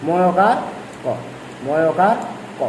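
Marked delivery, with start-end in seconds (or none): none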